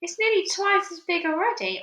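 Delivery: high excited call; excited shout. Acted. Aunt calling to Sponge, high excited shout. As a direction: shout it to her sister. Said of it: "Aunt Spiker shouted".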